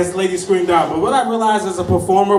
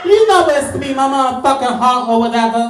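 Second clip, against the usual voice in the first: higher, louder mock sing-song; higher tease; 4 semitones higher, 5 dB louder than usual. Comic to crowd, raised teasing sing-song. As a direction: tease it up a notch.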